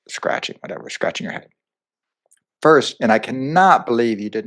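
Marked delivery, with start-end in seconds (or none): 0.1-1.5 half-whispered self-correction under the breath; quietly muttering a correction of her own words; muttered aside. Real, not acted. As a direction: mumble the fix quietly to yourself.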